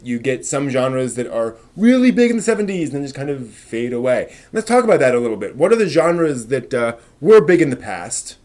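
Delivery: energetic host kicking off the Great Debate; show intro. Acted. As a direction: announce the segment like a stream host. Show host opens the debate segment.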